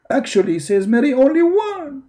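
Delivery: high, rising mock quote; mock disbelief. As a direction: climb high on the claim, incredulous. Repeats the claim in mockery.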